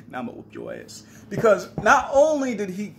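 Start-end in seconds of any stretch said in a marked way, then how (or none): 0.1-1.0 low whispered threat. Retold or imitated. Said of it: "I'm like".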